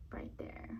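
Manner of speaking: soft whispered aside; whispering. Acted. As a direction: breathe it without voice. Whispered aside during picture card.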